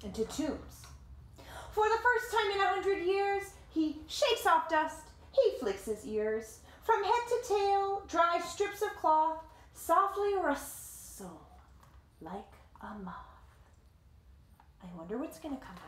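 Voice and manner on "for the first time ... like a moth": lively read-aloud voice, higher than the chat; story narration. A narrator's reading. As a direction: tell it like a picture book to kids. Reading the picture book aloud.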